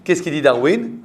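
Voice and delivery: normal talking voice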